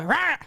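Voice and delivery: short high mock-angry "rah"; sudden outburst. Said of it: "then you can just go".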